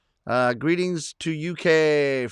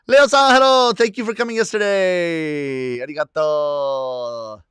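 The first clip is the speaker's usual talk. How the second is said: calling out a greeting; 5 dB louder, slower than usual. Real, not acted. Host greets Leo-san for coming yesterday, calling out loud and long. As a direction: hail a friend, stretching the final words. Hailing a viewer who joins.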